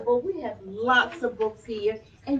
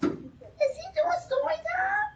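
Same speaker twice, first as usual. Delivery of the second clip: much higher, slow, near-shout question; excited. A narrator's reading. Children hearing about story time, excited high shout. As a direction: gasp with delight at the news.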